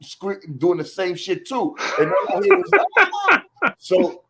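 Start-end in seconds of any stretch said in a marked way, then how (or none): 2.0-3.4 high mock yelp of pain; imitated yelp. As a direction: squeal it like the hurt friend. Dogs attacking outside the gate.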